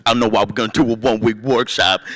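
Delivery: exaggerated put-on voice imitating a buddy; mock friend's voice. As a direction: ham up an odd voice for the friend. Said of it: "He doesn't talk like that".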